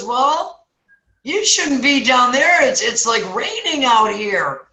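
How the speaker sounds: high, slow, stretched-out words; clueless passerby. Acted. Passerby advises man stuck in hole, acted slow voice. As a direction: play it dim and drawn out.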